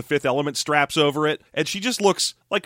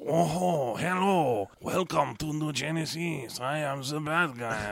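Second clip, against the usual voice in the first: hushed, slow mock French villain; bad French whisper; 8 dB quieter, slower than usual. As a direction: murmur it slowly in a hammy French accent. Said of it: "a dude who whispers with a bad French accent".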